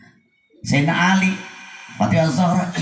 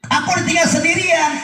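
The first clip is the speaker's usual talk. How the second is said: full-force preaching; 13 semitones higher than usual. Acted. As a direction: cry out the orphan's plight. Preached dialogue with orphan.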